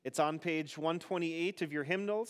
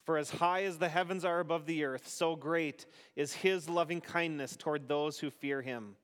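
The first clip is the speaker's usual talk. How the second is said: responsive psalm reading. Acted. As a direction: lead the psalm and leave room for the congregation.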